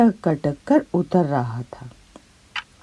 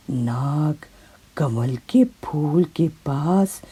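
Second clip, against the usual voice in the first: usual speaking voice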